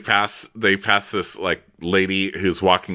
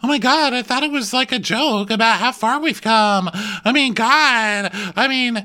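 much higher, exasperated protest; flustered disbelief; 4 dB louder than usual. Retold or imitated. Brandy protesting it was only a joke, high flustered. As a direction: sputter in disbelief.

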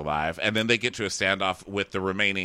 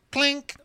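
high, short metallic clink; ping it like steel hitting ground; high-pitched vocal clink; imitated sword clink.

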